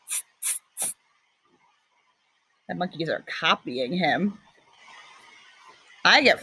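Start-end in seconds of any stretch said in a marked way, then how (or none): 0.1-0.9 whispered teasing tsk tsk tsk; monkey tsk. Acted. The monkeys mock the peddler, whispered tuts. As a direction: tut back like a mocking monkey. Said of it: "the monkeys ... said".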